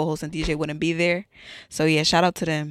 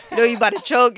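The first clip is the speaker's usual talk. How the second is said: ordinary talk, nothing marked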